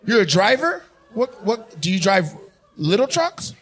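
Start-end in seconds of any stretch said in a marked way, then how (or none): none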